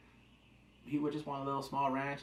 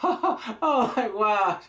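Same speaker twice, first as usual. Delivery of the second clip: laughing; 7 semitones higher, 9 dB louder, much slower than usual. Real, not acted. Laughing at the show.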